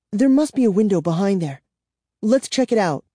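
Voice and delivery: eager and quick, high-pitched; excited. Acted. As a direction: rush it out with hope. Kara excited at window.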